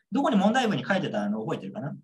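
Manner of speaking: normal talking voice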